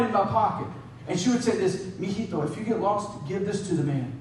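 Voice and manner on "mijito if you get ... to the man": low, soft motherly instruction; hushed imitation of a caring mother; tender mom. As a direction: confide it gently to a small child. Mom instructing her small child.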